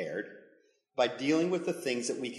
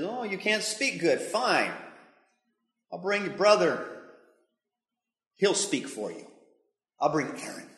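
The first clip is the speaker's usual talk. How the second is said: a bit ticked off; 5 semitones higher, 4 dB louder than usual. Acted. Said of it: "God's getting a little ticked".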